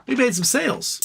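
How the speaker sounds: mock company voice. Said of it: "they go, "Hey".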